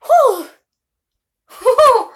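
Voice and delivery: loud high shaken "whew" after a real fright; startled gasp. Real, not acted. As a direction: blow out the fear in a loud high exhale. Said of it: "That was scary".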